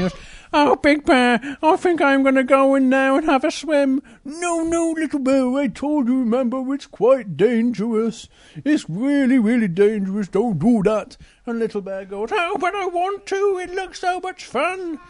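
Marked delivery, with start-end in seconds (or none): 0.5-4.0 high, eager little bear. Acted. Little Bear begs to swim. 4.2-11.2 high, insistent warning voice; Big Bear warning. Acted. Big Bear warns Little Bear. 12.3-15.0 very high pleading cub; whiny Little Bear. Acted.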